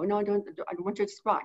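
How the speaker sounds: words run together fast and a touch soft; rushed talk. Retold or imitated. Owner showing her rushed speech to the bird, fast. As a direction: rattle it off, squashing the words. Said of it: "that's how I sounded".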